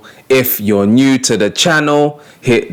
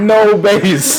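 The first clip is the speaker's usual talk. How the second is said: mock no-bass voice; 11 semitones higher than usual. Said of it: "there's no bass in the voice".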